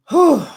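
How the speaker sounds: loud, high exhaled whew. Real, not acted. Reviewer reacting to Michael being out two days, a loud whew. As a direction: let out a sharp, high whew.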